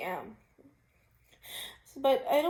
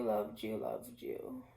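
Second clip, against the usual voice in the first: tearful; 13 semitones lower, 5 dB quieter, much slower than usual. Real, not acted. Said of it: "I'm crying on social media again".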